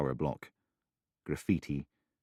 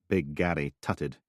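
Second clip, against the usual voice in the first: ordinary delivery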